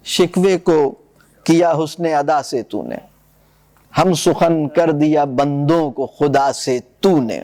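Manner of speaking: reading God's reply in verse to students; slow verse recitation. Acted.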